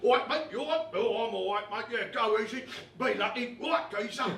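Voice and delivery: loud imitation of geezer chat; laddish mates' banter. Imitating how he and his mates talked, loud geezer banter. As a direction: put on the matey lads' greeting. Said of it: "that's how my mates and I used to talk".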